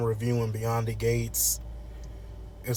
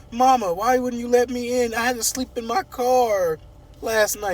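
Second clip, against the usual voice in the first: high-pitched, whiny protest; mock wounded whine, high and insistent; hurt daughter complaining; 10 semitones higher, 3 dB louder than usual. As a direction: wail the complaint at Mama. Eva complains to her mother.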